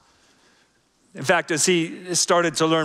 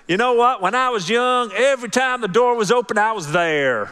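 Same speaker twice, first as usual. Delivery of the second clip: put-on Southern accent; 7 semitones higher, 3 dB louder than usual. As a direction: voice him like a Southern old-timer. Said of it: "I have no idea why I did that in the Southern accent".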